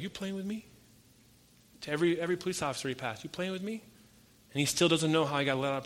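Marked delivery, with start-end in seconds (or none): 0.0-0.7 stunned disbelief. Retold or imitated. Freed prisoner doubting the guard. 3.2-3.8 stunned disbelief. Retold or imitated. Freed prisoner doubting the officers.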